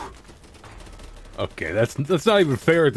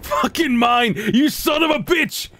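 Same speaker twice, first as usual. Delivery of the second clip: triumphant angry yell; 8 semitones higher, slower than usual. Real, not acted. Enemy killed.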